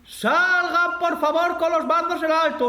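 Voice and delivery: mock police megaphone; megaphone call. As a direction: announce it through a bullhorn.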